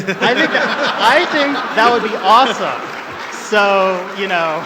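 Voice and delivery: loud, high laughing gush; laughing excitedly. Real, not acted. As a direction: laugh through it, thrilled by the idea. Fan laughing excitedly at idea.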